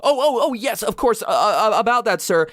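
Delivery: high, loud nervous stammer; flustered stammering. Retold or imitated. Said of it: "Clawhauser's kind of stammering".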